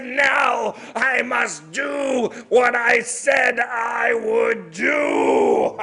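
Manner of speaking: loud, slow, drawn-out monster threat; booming spirit. Acted. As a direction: roar the vow down at him. Snow woman about to kill.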